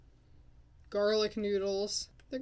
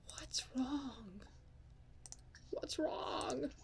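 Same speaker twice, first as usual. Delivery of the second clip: soft whisper; 4 semitones higher, 11 dB quieter, much slower than usual. Real, not acted.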